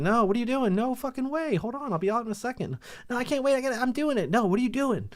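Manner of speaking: high-pitched back-and-forth squabble; mock panicked argument. Couple arguing over the toilet, high panicky mock voices.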